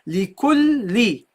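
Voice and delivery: "Li kul li" slowly, l doubled before li; two lams. Shown as a demonstration. Teacher shows the second lam, doubled l. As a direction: join "kul li" with a long l. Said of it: "the second lam with a kasra".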